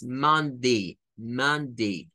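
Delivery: "Monday" ending in a short "di", slow; model pronunciation. Shown as a demonstration. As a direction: end "Monday" on "di", not "die". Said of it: "No lo leemos como I". ("Monday" terminando en "di")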